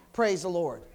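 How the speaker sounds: ordinary delivery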